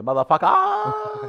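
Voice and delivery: high, drawn-out final syllable; imitated high wail, held long; Kim Jong impersonation. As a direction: copy an impression and stretch the word way up high. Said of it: "Kim Jong impersonation of Gartner's impersonation".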